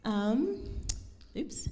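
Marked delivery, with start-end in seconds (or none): none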